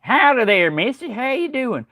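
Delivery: high-pitched, hammed-up country greeting; old country guy. Old country guy greeting a missy, hammed-up and high. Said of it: "think that I'm just an old country guy".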